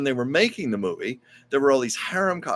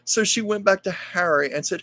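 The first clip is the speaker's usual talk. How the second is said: ordinary delivery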